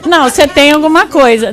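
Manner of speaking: loud acted husband pressing the point; mock insistence. Husband pressing his wife who denies being upset, mock insistent.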